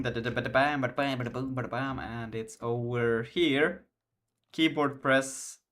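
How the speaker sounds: normal talking voice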